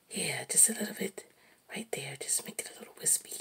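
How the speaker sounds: soft unvoiced whisper, gentle coaching; imitating the painter's whisper. Childhood TV painter coaching his brushstrokes, whispered. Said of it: "he would be talking like this".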